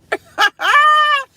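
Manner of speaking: high squealing burst of laughter; a loud high shriek of a laugh. Real, not acted. Big laugh at her story.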